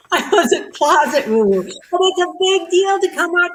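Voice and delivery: amused self-mocking confession; laughing. Real, not acted. Laughing at herself.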